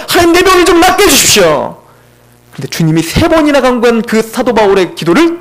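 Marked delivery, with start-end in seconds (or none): 0.1-1.8 urgent plea. Retold or imitated. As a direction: beg God with rising urgency. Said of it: "간구했습니다".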